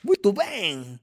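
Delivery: festive Brazilian party invite. Retold or imitated. Imitating Brazilians welcoming someone to a party, high and festive. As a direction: invite them in like it's a party. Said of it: "if we keep thinking, like".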